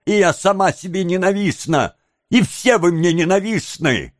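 high-pitched, embittered tirade; bitter outburst. A narrator's reading. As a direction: pour out hatred, high and heated.

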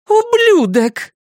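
high, drawn-out angry curse; enraged woman's loud, stretched-out cry; furious insult. A narrator's reading. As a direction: hurl it slowly and high. Woman curses man after punching him.

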